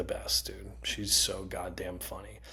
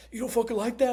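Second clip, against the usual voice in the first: high, louder mock yell; retold yell; 13 semitones higher, 5 dB louder, faster than usual. Dad yelling at his son in the drive-thru, high mock yell. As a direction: bark it like an outraged dad. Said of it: "he yelled at me once".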